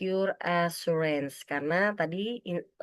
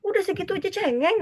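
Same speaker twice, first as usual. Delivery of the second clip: mocking dismissal; 13 semitones higher, faster than usual. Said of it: "Jangan langsung".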